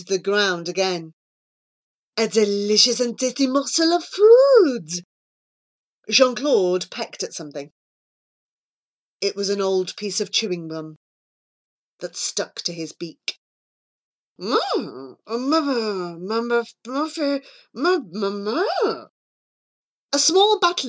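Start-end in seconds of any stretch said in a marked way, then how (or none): 2.2-5.0 delighted. Acted. Jean-Claude eyeing the ground, delighted by food. 14.4-19.1 muffled, wordless struggling mumble; beak gummed up. Acted. Jean-Claude with gum stuck to his beak, muffled.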